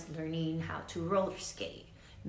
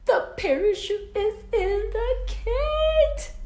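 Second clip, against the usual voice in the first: much higher, louder, slowed exclamation; dramatic realisation; 12 dB louder, slower than usual. A narrator's reading. Teacher revealing the parachute problem, loud and high. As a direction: hammer home the big mistake.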